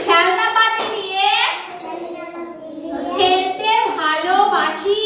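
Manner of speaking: very slow, word by word; slow modelling. Shown as a demonstration. Teacher models Ishan's answer word by word, slowly. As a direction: stretch it out for a small child to repeat. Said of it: "বলো".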